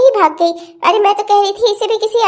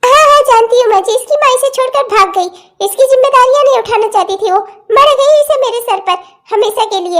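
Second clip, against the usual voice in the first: exasperated retort; 3 semitones higher than usual. Acted. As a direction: throw it back at her, fed up. Aunt snaps back about child's mother.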